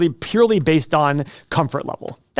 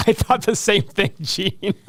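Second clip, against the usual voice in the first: laughing; 5 semitones higher, slower than usual. Real, not acted. Laughing at viewer's joke.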